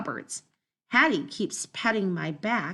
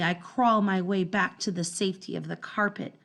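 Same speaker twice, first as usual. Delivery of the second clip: ordinary delivery, nothing marked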